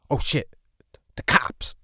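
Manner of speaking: sudden loud warning, acted out; retold alarm. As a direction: imitate a friend spotting trouble. Friend's alarm on seeing police.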